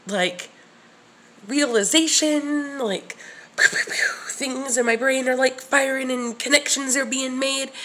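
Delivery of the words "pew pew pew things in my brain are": whispered zapping sounds; whispered pew pew. Pretend. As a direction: whisper little laser zaps. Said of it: "mimics neurons firing".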